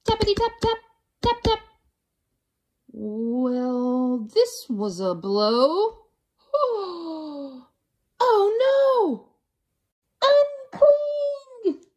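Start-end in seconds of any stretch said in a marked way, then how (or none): 0.1-1.6 high, crisp tappity tap; tapping sound. A narrator's reading. Woodpecker sound imitated. 6.5-9.3 alarmed gasp. A narrator's reading. Alarm at the sound. 10.2-11.8 high, loud yell upward; crowd yelling. Acted. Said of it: "the crowd yelled".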